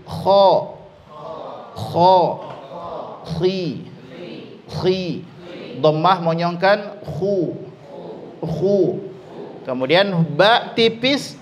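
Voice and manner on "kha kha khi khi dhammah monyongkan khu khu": "kha", "khi", "khu" each said clearly; drilled vowels. Shown as a demonstration. Teacher drilling kha, khi, khu for students. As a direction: spell out each vowel cleanly. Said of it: "Sekali lagi".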